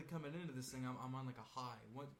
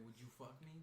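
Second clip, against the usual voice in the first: creepy movie quote; 9 dB quieter than usual. Retold or imitated. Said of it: "like Goodbye Horses in the Hannibal".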